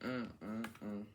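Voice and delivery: usual speaking voice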